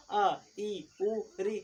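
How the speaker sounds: vowels अ, ई, उ said briefly; short vowels. Shown as a demonstration. Teacher demonstrating short vowels to students, short and quick. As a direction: give each vowel the least time. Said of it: "सबसे कम समय लगे".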